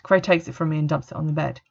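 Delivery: ordinary delivery, nothing marked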